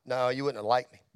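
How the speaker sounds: very low, soft contradiction; low, subdued quote. Retold or imitated. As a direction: imitate a friend quietly brushing off affection. Same friend answering, low again.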